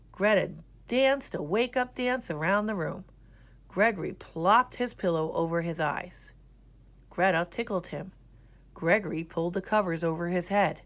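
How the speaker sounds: plain reading voice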